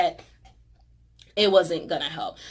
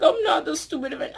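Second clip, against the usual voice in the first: high, fast, tearful mock Hulk; hurt Hulk; 7 semitones higher, faster than usual. Vlogger voicing Hulk insulted by Thor, high tearful whine. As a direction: whine it half laughing, half crying. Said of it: "He's like".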